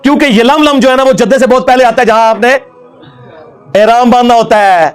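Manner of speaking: high-pitched, exultant debunking tirade; mocking outburst. Real, not acted. Preacher ridiculing a rival's holy-man tale, mocking outburst. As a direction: pitch it up and crow over the blunder.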